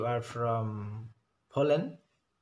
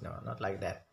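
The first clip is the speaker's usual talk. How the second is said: ordinary talk, nothing marked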